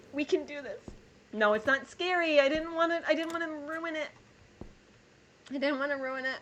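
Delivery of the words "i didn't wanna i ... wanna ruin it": higher, tearful "I didn't wanna ruin it"; teary apology. Real, not acted. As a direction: apologise through tears. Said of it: "Emotionally scary".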